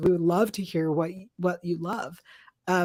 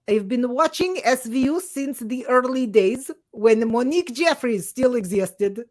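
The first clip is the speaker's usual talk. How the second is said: slightly raised, put-on Russian accent; 3 semitones higher than usual. Co-host reads listener's five-star review, put-on Russian accent. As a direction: read it out in a hammy Russian accent. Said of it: "This accent's awful".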